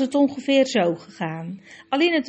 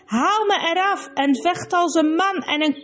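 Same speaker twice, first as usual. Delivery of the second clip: hoog en luid, vernederd en razend; woedend brullen; 5 semitones higher than usual. Acted. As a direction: brul het uit terwijl je hulpeloos aan de muur hangt. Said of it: "brulde Rabadash". (woedend gebrul van een vastgehaakte prins)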